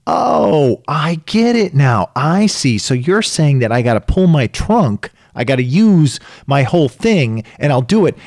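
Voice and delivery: aha moment. Acted. Imagined student telling the coach they understand, enlightened. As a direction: act a student suddenly getting it. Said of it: "has an aha moment".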